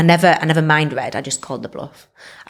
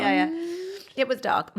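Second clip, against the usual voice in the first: laughing; 9 semitones higher than usual. Real, not acted. Joking with friends about the dark club, giggling. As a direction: giggle the excuse out high.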